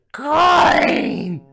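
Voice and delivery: very high, long-held scream; crazed piercing yell. Acted. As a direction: shriek it, stretching the vowel. Escalating shouting exchange with coin.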